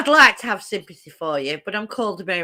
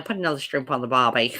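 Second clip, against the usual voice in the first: lower-pitched Australian one-liner; Australian accent; 7 semitones lower than usual. Shown as a demonstration. Showing the one line of Australian they can do, Australian accent. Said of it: "I can do an Australian".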